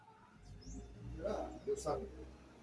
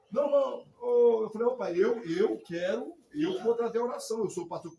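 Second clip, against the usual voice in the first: much louder, higher insistence; loud claim; 7 semitones higher, 10 dB louder than usual. Retold or imitated. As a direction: claim the prayer loudly. Retold stepping in to pray.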